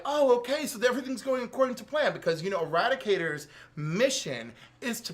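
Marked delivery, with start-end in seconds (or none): 0.0-2.1 quick, high, self-satisfied patter; smug scheming villains. Retold or imitated. Villains gloating as the plan works, fast smug patter. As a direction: rattle it off like the plan is going perfectly. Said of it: "Cyborg, Superman, and Eradicator are like".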